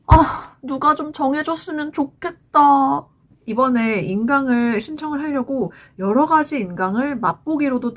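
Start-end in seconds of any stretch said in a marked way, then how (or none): 0.0-3.1 higher, drawn-out exasperated wish; acted frustration. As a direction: groan it slowly, like you are fed up choosing. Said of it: "정말 깝깝했어요".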